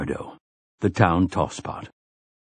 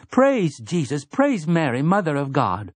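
higher woman's voice, prayerful; woman praying; 7 semitones higher than usual. A narrator's reading. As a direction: praise it like a devout woman. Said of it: "And a woman's voice".

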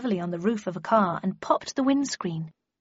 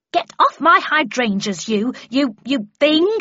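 high, loud, sputtering shout at the beast; defiant yell; 4 semitones higher, 6 dB louder than usual. A narrator's reading. Mum shouts at the mammoth in her hydrangeas, loud and sputtering. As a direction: chase it off, lost for words. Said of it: "she yelled defiantly".

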